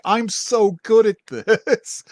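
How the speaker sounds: high, slow, drawn-out retold delight; mock-innocent boasting, stretched out high; gleeful pride. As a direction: imitate someone thrilled with their own skill. Voicing character's innocent glee.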